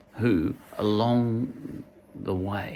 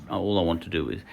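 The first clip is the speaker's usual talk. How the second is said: ordinary talk, nothing marked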